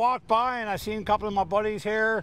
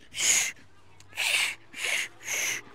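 shushing; 5 dB quieter, much slower than usual. Retold or imitated. Librarian shushing in joke.

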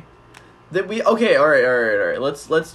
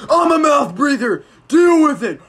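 defiant shout; 9 semitones higher, 6 dB louder than usual. Real, not acted. Defiant declaration, loud.

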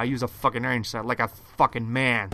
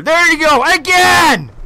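raging gamer; 14 dB louder, slower than usual. Real, not acted. Gamer yelling after dying.

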